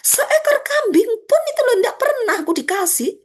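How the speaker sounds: high, loud aggrieved complaint; aggrieved whine. Acted. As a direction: whine that he never got even a goat. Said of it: "Tapi dia merasa".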